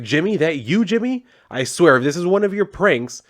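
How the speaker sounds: retold wary demand; suspicious calling out. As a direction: call out accusingly, as if to a hidden prankster. Trapped woman calling to suspected prankster.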